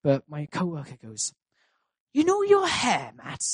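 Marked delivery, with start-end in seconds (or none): 2.1-3.4 put-on high-pitched South Wales Valleys accent; welsh valleys accent. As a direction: coworker in a broad Valleys accent. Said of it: "a South Wales Valleys accent now".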